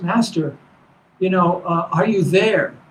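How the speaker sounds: ordinary delivery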